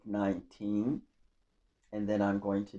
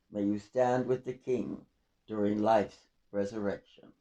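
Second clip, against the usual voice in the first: calm, reverent poetry recital; poem reading; 2 semitones higher, 5 dB louder than usual. Acted. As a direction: read it like a gentle prayer for the departed. Reads memorial poem aloud.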